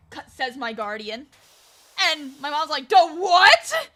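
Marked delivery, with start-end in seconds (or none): 2.9-3.9 loud, higher-pitched disbelief; acted startled exclamation; shocked reaction. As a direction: burst out as a stunned mom. Mom's shocked reply.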